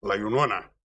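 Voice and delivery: low, stunned "La Iunona?" with a falling end; incredulous echo. Retold or imitated. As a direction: repeat "Iunona" in disbelief, dropping low. Repeated shocked echo.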